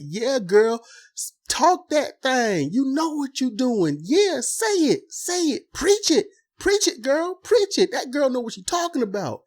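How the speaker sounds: high, excited call-and-response hype; hyped-up girlfriend cheering. Retold or imitated. Women cheering on the monologue, high excited hype. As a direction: egg her on like a cheering church crowd. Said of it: "And they're like".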